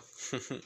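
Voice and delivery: soft, breath-only chuckle; teasing chuckle. Acted. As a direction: chuckle softly at your own tease. Teasing character chuckles after his request.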